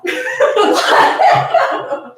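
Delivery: squealing laugh; high laugh. Real, not acted. Host cracks up at the part.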